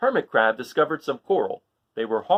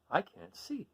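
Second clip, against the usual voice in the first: higher, much softer voice; a small lost plea; worried snail; 6 semitones higher, 9 dB quieter, slower than usual. Acted. Snail lost in dark seaweed, soft and higher. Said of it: "said the snail".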